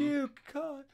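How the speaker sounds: high melodramatic protest; mock heroine's plea. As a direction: put on a high pleading voice. Acting out film heroine's plea.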